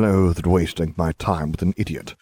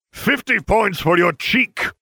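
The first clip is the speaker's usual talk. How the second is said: a higher, slow roared penalty; roaring; 13 semitones higher, 3 dB louder, slower than usual. A narrator's reading. Snape punishing a cheeky student, roaring. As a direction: bellow out the punishment slowly. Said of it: "Severus roared".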